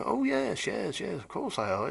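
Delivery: high, breezy mock voice brushing off the question; imitating James. Owner James shrugging off the question, high mocking imitation. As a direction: toss off the reassurance in a lifted, too-sure voice.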